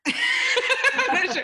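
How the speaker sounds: high, loud, laughing admission. Real, not acted. Guest stumped for an invention, laughing helplessly. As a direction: burst out laughing while admitting it. Cracks up at having no answer.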